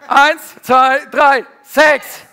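rally the room, shout it; shouted countdown. Real, not acted. Preacher firing up the congregation, shouted countdown. Second round of congregation call.

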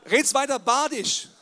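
slow, loud, high needling; loud mock challenge. Real, not acted. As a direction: tease the listener with a loud slow jab. (laut und langsam neckend)

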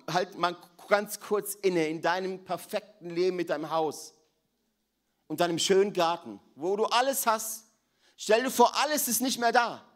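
high and loud, building to full force; preacher urging listeners to imagine loss; fervent preaching. Acted. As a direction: drive it home to the congregation.

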